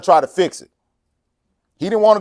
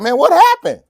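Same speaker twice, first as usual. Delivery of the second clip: nosy acquaintance; 10 semitones higher, 5 dB louder than usual. Retold or imitated. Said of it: "He's like".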